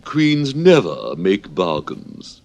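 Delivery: slow, haughty royal pronouncement; stiff Red Queen. Acted. Red Queen refusing Alice's bargain, slow and stiff. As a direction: draw yourself up and declare it stiffly. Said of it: "drew herself up rather stiffly".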